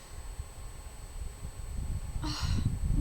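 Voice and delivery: long, emotional exhale; long shaky-hearted sigh. Real, not acted. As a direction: sigh it out after crying. Sighing after being moved to tears.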